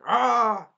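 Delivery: loud imitated battle cry; hammed-up roar of fury; rage scream. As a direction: yell out mock rage. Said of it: "taking out his shield rage".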